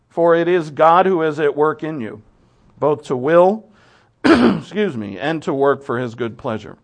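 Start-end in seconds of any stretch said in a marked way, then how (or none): 2.8-6.8 scripture reading. Acted.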